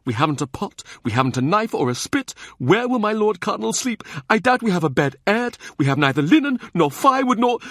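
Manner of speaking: an anxious steward who keeps piling up worries; anxious and sweating. A narrator's reading. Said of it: "anxious, sweating".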